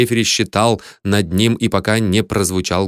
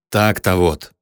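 low, understated gloating; gloating hiss; 4 semitones lower, slower than usual. A narrator's reading. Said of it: "злорадно прошипел Лимми". (злорадно, вполголоса, низко)

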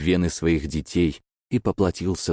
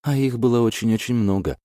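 quick, higher nervous chatter; rattling on nervously; 6 semitones higher than usual. Acted. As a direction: babble it out, anxious. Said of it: "тараторила она".

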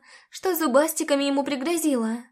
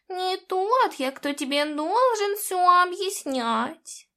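a cat wriggling out of answering, drawn out; innocent-acting talking cat; evasive cat; 3 semitones higher, much slower than usual. A narrator's reading. Cat dodges, backing away.

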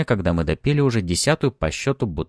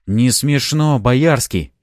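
loud, raised, angry retort; angry rebuff; 5 semitones higher, 5 dB louder, much slower than usual. A narrator's reading. Fyodor refuses Boyarsky's joke, angry rebuff. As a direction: cut the joke off angrily. Fyodor rejects the mockery angrily.

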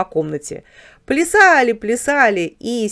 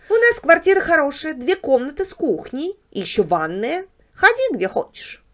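higher-pitched, proud character voice; Barbos the house dog; 8 semitones higher than usual. Acted. House dog describes his flat to a guest, proud. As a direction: show off like a contented house dog.

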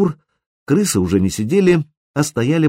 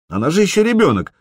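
notably higher voice; Мистле; 9 semitones higher, faster than usual. A narrator's reading. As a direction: chime in high. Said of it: "вставила Мистле". (Мистле вставляет, высоко)

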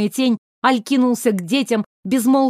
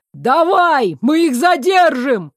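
shouting; 5 semitones higher, 4 dB louder, slower than usual. A narrator's reading. Friends shout urging him on.

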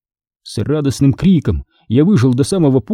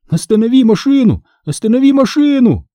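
yelling; 10 semitones higher than usual. Acted. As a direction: scream at the runaway car to stop. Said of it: "вопил я". (отчаянный крик)

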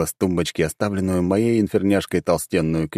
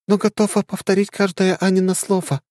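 high, steady and loyal; firm young heroine; young woman voice. Acted. Ariel voice.

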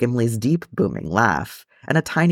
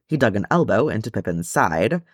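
ordinary delivery, nothing marked

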